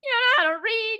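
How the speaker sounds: high, loud disbelief at the heroine; incredulous rant. Acted. Ranting at the book's heroine in loud, high disbelief. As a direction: demand it of the clueless heroine.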